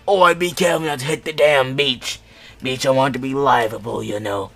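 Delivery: loud put-on pirate shout; rusty-voiced yell. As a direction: yell over explosions in a rusty character voice. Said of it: "I hear a rusty voice yell".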